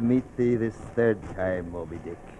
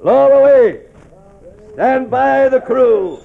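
bellowed orders; 10 semitones higher, 6 dB louder than usual. Acted. Captain orders the boats lowered, a loud high bellow. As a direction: bellow it out to the whole crew.

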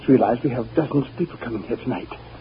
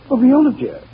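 calling out; 9 semitones higher, 7 dB louder than usual. Acted. Husband calls loudly for his wife Viola. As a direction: call her name loud and high.

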